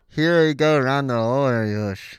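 talking with a mouthguard in; words blurred by a mouthguard. Real, not acted. He got the mouthpiece in already.

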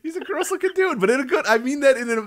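high, giggly explaining; talking while still laughing; after laughing hard. Real, not acted.

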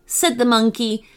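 plain reading voice

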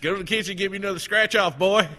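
loud, high mock parental order; bossy parent. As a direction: holler at the kid, hammed-up. Joking as a lazy, bossy parent.

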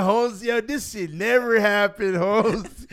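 put-on high, drawn-out comic Mexican accent; mock Mexican accent, slow and high; Mexican driver accent. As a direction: imitate a Mexican Uber driver warning his passengers. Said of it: "the Uber driver Mexican dude".

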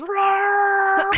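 high, very loud screeching roar; broken toy roar. Retold or imitated. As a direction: let out a shrill broken roar. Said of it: "now it just goes".

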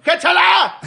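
high, loud laughing yell; shouting. Retold or imitated. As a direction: yell it as the ball flies off. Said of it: "cuando se te volaba el balón".

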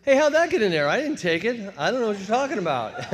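high, loud protest of innocence; feigned innocence. Retold or imitated. Caught teen protests innocence to the police, loud and high. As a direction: play dumb, loud and high.

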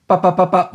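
high popping gunshot noises; imitated gunshots. Imitating the gunshots heard over the phone line. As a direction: pop pop like distant gunfire.

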